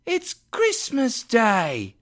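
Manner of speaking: put-on high, drawn-out boy's call. A narrator's reading. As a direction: call it up slowly as an incredulous street boy. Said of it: "replied the boy".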